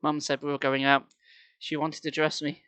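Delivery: ordinary delivery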